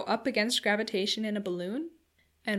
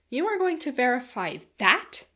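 incredulous question; 4 semitones higher, 5 dB louder than usual. A narrator's reading. Incredulous question after crying out.

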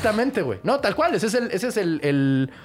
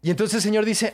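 ordinary delivery

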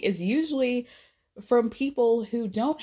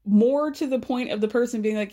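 normal talking voice

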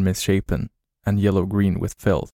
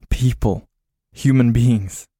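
higher, louder joyful outburst; excited relief; 4 semitones higher, 4 dB louder, slower than usual. A narrator's reading. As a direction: exclaim with sudden hope. Relief seeing humans.

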